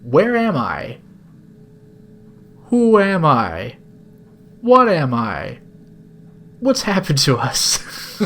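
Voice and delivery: drawn-out theatrical questioning; playfully overwrought bewilderment; mock melodrama. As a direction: ham up each existential question. Mock existential questions to chat.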